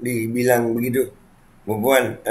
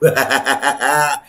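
short laugh. Real, not acted. Laugh after "ketawain aja.

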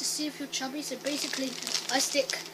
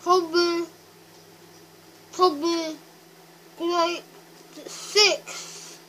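cheeks stuffed full; 4 semitones higher, 8 dB louder, much slower than usual. Real, not acted. More grapes stuffed in.

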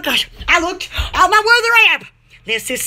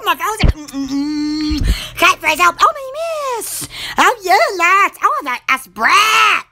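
usual speaking voice

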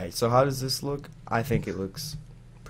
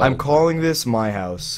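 loud insistence; 3 semitones higher, 6 dB louder than usual. Real, not acted. Friends bickering over the house.

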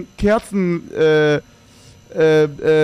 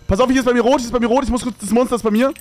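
alarmed; 6 semitones higher, faster than usual. Real, not acted. Monster is near, alarmed.

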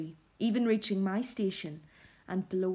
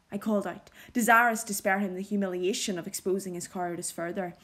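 ordinary delivery, nothing marked

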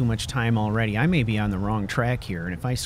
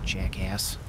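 muttered insult; 6 semitones lower, 8 dB quieter than usual. Real, not acted. Insult after a fight.

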